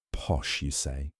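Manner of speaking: near-whispered, dry Poirot retort; hushed Poirot. Acted. Poirot softly questioning Hastings' sloppy word, near whisper. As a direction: breathe the question back, barely voiced.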